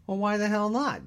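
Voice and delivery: high, loud indignant question; outraged challenge. Acted. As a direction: demand why, utterly offended.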